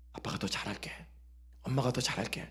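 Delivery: put-on whispered, tender promise to a child; hushed parent vowing to do better; soft parent promise. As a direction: breathe it gently like a sorry parent.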